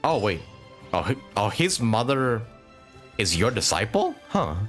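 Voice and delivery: normal talking voice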